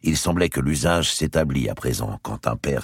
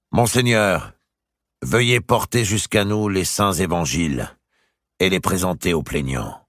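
lightly voiced solemn order; king's command; 6 semitones higher, 3 dB louder than usual. A narrator's reading. The king ordering the bishop to bring the gospels. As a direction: command with royal calm.

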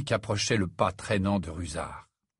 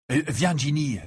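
usual speaking voice

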